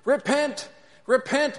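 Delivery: prophet's high, loud cries; Charlton Heston. Retold or imitated. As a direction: cry it out repeatedly while being dragged away. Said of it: "Charlton Heston is doing his thing and he's saying".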